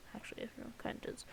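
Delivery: quick breathless whisper slipped in; whispered aside. Real, not acted. Sneaking the joke back in to the viewers, whispered. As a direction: let it out fast under your breath, like a secret. Joking aside after retracting.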